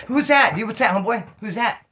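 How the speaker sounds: high, loud, startled questions, spooked; jumpy paranoia. Retold or imitated. Retelling his PTSD on the road, spooked high-pitched alarm. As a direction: act out jumping at shadows, half mocking yourself.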